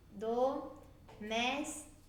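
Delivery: "domésticos" split slowly into do, més, ti, cos; syllable by syllable. Shown as a demonstration. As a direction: say "domésticos" in four drawn-out pieces with clear breaks. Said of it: "separar em sílabas é separar".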